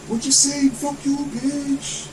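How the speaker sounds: very high mock local Hawaiian accent; squealed Hawaiian-accented taunt, drawn out at the end. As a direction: imitate an angry neighbor with a Hawaiian local accent. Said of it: "Like, local accent".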